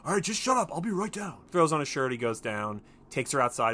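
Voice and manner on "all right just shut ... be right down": annoyed shout. Retold or imitated. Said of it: "and he's like".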